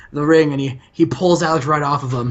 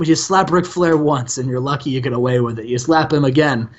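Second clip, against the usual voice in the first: ordinary talk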